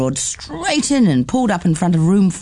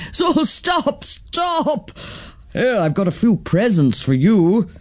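high mock protest under hugs; laughing off the children's crush; Auntie Twinkle playful; 6 semitones higher than usual. Twinkle playfully fending off hugging children.

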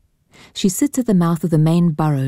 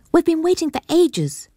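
put-on high, girlish and impatient; a young lady complaining of the long wait; 12 semitones higher than usual. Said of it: "she says".

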